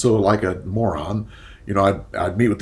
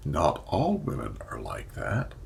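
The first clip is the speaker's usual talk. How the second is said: quoted public reply; 7 semitones lower, 6 dB quieter than usual. Retold or imitated. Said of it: "The first thing you hear is".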